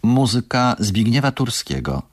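normal talking voice

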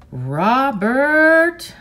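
loud, high call from far off. Acted. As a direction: call his name across the field. Father calling from the motel.

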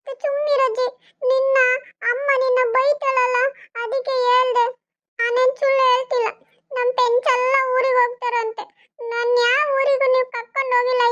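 put-on high, childish whine; whiny little boy. Little boy whining to grandma about school, high childish voice. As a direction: play a small boy refusing to go.

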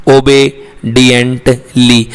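"ओबीडिएंटली" slowly in three parts, "o-be... dient... lee"; broken into parts. Shown as a demonstration. Teacher breaking the word for spelling, "ओबीडिएंटली" slowly as "obe-dient-lee". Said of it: "इसको तीन part में तोड़ लो".

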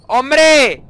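loud, high startled shout; startled yell. Real, not acted. Gamer startled by the game, yelling loud and high. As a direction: jump and yell out.

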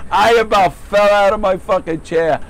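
ordinary talk

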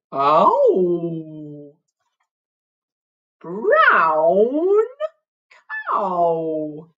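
long, mooing cow voice; best cow voice. Acted. Teacher leads the girls in a silly drawn-out cow voice. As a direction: moo the words out, hugely stretched. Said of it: "In your best cow voice now".

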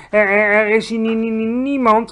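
high, loud, stuttering denial; nervous stammering. A narrator's reading. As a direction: stammer on each word, dead nervous. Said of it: "hakkelde hij".

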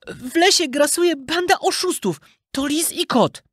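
high-pitched puppet voice reporting a crime; polite puppet. A narrator's reading. Puppet reporting swindlers to the judge, politely. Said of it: "powiedział uprzejmie".

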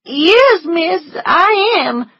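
high, slow crying maid; tearful agreement. A narrator's reading. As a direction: admit it in tears. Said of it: "agreed Prissy".

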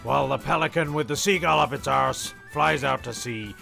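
normal talking voice

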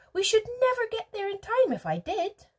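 high, loud protest; urgent refusal. A narrator's reading. Wonka refuses while hurrying on.

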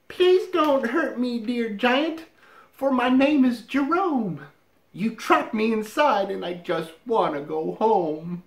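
high, small pleading mouse voice. Acted. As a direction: beg like a tiny frightened mouse.

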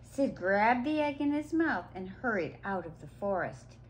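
usual speaking voice

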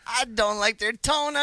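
high, loud mimicry stressing "donuts"; mocking her "donuts". Host mimicking interviewed woman's "donuts", high and mocking. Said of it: "she said donuts".

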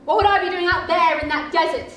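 defensive outburst. Acted. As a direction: brush it off hard. Husband denies accusation.

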